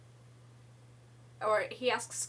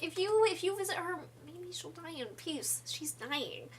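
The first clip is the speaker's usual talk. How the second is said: pleading townsfolk; 7 semitones higher than usual. Retold or imitated.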